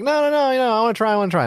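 put-on high, pleading child voice; insistent kids. Dad imitating his kids begging to try, high whiny voice. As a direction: beg like a kid who wants the candy.

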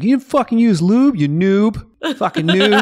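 high, loud jeering voice; mocking friends. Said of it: "Everyone's like".

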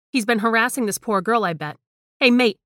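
loud, high call to the cubicle; shouting out. Acted. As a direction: yell at him, bold and brash. Chantel calls out at cubicle.

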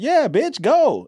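high, loud mock girlfriend cheering him on; mock Blair. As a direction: squeal it like a careless girlfriend. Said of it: "And Blair's like".

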